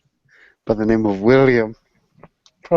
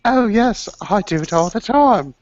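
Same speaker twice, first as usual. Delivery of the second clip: put-on much higher, airy girlish voice; mock young woman, bright and higher; breezy young woman; 11 semitones higher than usual. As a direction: toss it off like a carefree blonde girl. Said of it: "the lovely blonde girl was like".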